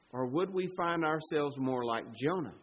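ordinary talk, nothing marked

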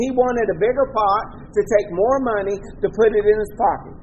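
ordinary delivery

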